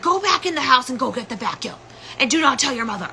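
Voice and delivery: high mock-yelled command; retold angry order. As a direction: imitate dad ordering someone off. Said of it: "He's flipping out on me".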